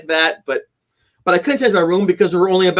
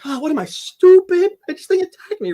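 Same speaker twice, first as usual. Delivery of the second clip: sends up his panicked younger self, pitched way up; mock scared self; 13 semitones higher than usual. To the audience, mocking his scared younger self, in a high squeaky voice.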